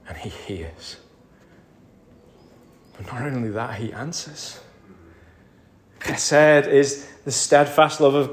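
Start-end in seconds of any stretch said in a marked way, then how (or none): 0.1-1.0 hushed, breathy whisper. Acted. Preacher whispers to the congregation that God hears. 3.0-4.6 hushed preaching. Acted. Soft intimate preaching.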